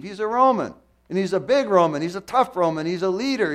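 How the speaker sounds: high, loud, driving sermon delivery; full-force preaching. Acted. Preacher pressing the congregation about the centurion, loud and high. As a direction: hammer each question at the congregation.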